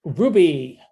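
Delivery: calling out. Real, not acted. Host summoning a silent graduate, calling out. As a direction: call the name out, falling at the end.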